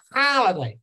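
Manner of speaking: holiday, US "hal"; American holiday. Shown as a demonstration. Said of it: "Americans say it differently".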